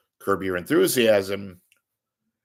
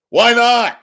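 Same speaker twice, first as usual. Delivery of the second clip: exclaimed; 13 dB louder than usual. Real, not acted. Mock answer to own question.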